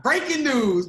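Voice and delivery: loud, high mock news-flash announcement; playful loud news-alert shout; breaking news call. Announcing colleague's surprise vote.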